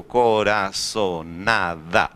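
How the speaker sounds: coraçonada very slow and low, each syllable separated; syllable-by-syllable demo. Shown as a demonstration. Teacher asking students where the stress falls, saying coraçonada in slow syllables. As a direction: spell out coraçonada slowly by syllables, co-ra-ço-na-da. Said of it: "Como que eu falo essa palavra? Digo".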